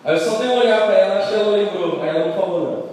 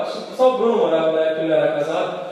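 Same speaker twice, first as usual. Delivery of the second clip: ordinary delivery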